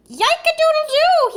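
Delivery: high, loud crowing yelp; shrill-pitched rooster cry of alarm; startled rooster cry. A narrator's reading. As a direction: crow like a rooster with a sore tail. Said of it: "he cried".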